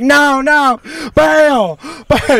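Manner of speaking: high, urgent mock holler; imitated panicked shouting. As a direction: yell across the yard to call it off. Imitates yelled refusal from dock.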